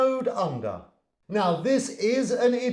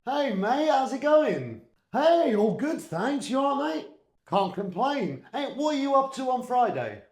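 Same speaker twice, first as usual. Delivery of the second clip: lightly raised, bright casual greeting voice; acted friendly chat; 6 semitones higher than usual. Teacher acting a casual chat between two mates, light and upbeat. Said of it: "real conversation acted by me".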